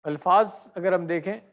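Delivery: usual speaking voice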